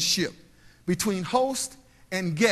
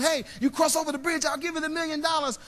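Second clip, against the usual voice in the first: high, sustained pretend holler; mock yell; 7 semitones higher than usual. Man across the ravine offers a million dollars, mock yell. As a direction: call it across a gap without real volume. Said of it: "and he starts yelling".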